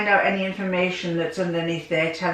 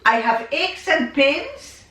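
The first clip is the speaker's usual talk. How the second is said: put-on a light French accent, pitched higher, unhurried at the start; 6 semitones higher, 3 dB louder than usual. Elderly father telling his son about aches, lightly French-accented. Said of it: "in his French accent".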